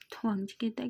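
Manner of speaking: plain reading voice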